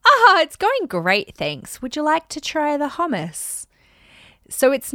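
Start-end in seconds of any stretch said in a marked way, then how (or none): 0.0-3.6 high slow sing-song cheer trailing off; fake-bright hostess voice, slow and high; sweet deflection. Shown as a demonstration. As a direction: breezily dodge the question with a high, slow lilt. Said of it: "You can go".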